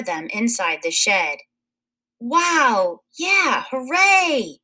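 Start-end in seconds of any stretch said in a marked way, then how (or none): 2.2-4.6 high excited cheering; children shouting. A narrator's reading. Narrator voicing children cheering at the zoo, excited. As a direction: cheer like thrilled kids. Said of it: "the children shouted".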